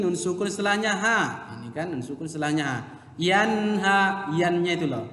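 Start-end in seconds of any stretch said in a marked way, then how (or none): 3.2-4.3 "Yanha" with a short distinct n; clear nun. Shown as a demonstration. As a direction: say "Yanha" without humming the n. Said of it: "nggak boleh dibaca samar". ("Yanha" dengan nun yang jelas)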